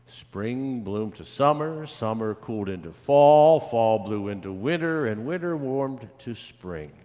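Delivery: put-on lively, lilting reading to children; storybook read-aloud. A narrator's reading. Pastor reads a picture book to children, lively storytelling. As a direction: read it out with clear, varied storybook expression.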